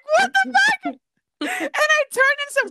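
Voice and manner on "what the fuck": high, loud startled cry; mock shocked scream, much higher and louder; shocked shriek. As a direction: yelp it as if suddenly exposed. Startled by rush of air.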